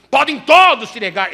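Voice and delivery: proud, loud declaration of loyalty; loud boast. Acted. Peter swearing to Jesus he won't deny, loud. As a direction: plant your feet and proclaim it.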